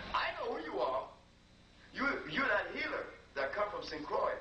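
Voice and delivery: high, knowing woman's voice; voicing the wife. Wife at the door greets the healer, in a knowing high voice. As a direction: sound like a woman who already knows.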